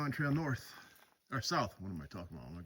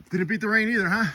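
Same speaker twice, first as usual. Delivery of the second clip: called out loud and higher; calling out; 7 semitones higher, 11 dB louder than usual. Real, not acted. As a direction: call up to the tower. Calling up to people in tower.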